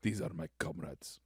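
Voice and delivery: low, soft Russian accent. Shown as a demonstration. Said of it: "say it with a Russian accent".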